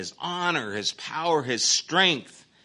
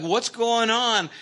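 bewildered question; 12 semitones higher, 5 dB louder, slower than usual. Retold or imitated. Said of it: "And we're like".

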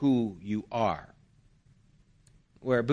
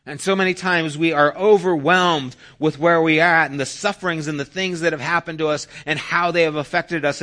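louder, higher, driving sermon voice; fervent preaching; 6 semitones higher, 7 dB louder than usual. Acted. Preacher urging the congregation forward, fervent. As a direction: build the call with conviction.

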